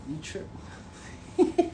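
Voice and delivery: slow words breaking into laughter; laughing. Real, not acted. Son laughing at his mom's reaction, laughing. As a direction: laugh it out, barely voicing.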